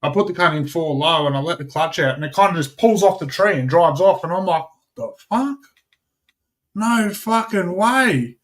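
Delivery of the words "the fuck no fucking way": high-pitched, drawn-out retold disbelief; high, slow exclamation of amazement; incredulous. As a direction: re-enact being astonished, pitched right up. Retold disbelief as car drives off.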